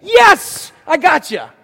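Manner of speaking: hammed-up winner yell; triumphant whoop. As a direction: crow like you won.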